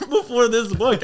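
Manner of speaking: half-laughing mock outrage at a friend; laughing disbelief. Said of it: "I'm just like".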